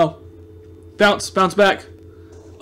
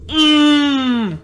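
high, strained frustrated moan; angry whine; 7 semitones higher than usual. Real, not acted. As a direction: squeal through a closed mouth. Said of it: "I'm so angry".

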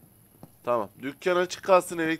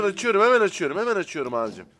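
ordinary delivery

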